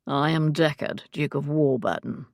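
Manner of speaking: ordinary delivery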